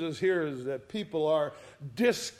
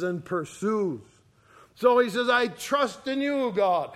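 louder, higher, pleading preacher's fervour; fervent preaching; 4 semitones higher, 6 dB louder than usual. Acted. Preacher voices David's plea to God, fervent and louder. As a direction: preach it out, pressing each line home.

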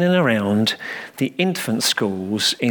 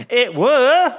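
put-on much higher, louder insistent child; boy crying out undeterred; 12 semitones higher, 5 dB louder than usual. A narrator's reading. Little boy insisting to the vicar, undeterred. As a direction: insist like a stubborn little lad. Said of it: "cried the boy, undeterred".

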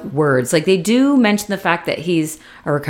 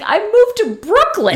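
high, pointed comeback; indignant retort. Retold or imitated. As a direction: fire it back at a friend.

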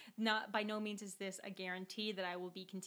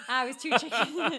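repeated louder through a laugh; 5 semitones higher, 13 dB louder than usual. Retold or imitated.

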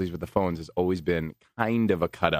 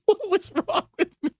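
high laughing self-mock; 4 dB louder than usual. Real, not acted. Host laughs at his own highway slip, high and giggling. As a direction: laugh at your own blunder.

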